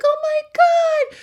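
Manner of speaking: high, slow, drawn-out horrified cry. Retold or imitated. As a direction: wail it in disbelief. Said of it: "And we're like".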